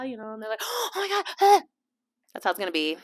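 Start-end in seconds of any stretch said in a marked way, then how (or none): 0.6-1.6 high breathy fan squeal; mock starstruck fan; starstruck gasp. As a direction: gush like a fan. Fan freaking out.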